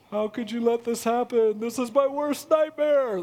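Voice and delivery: lightly voiced anxious dog; dog's imagined voice. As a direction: voice the dog's wounded outrage. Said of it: "That's how he talks".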